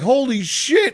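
high-pitched stunned exclamation; mock amazement. Young guy spotting two hot women, mock amazement. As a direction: play the awestruck young guy, voice jumping up.

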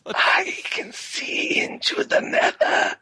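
slow, high ghostly wail; prophesy like a doomed wizard; unearthly voice. Acted. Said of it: "you're that guy from Harry Potter".

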